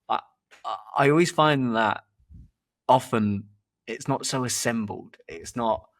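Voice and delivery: ordinary talk, nothing marked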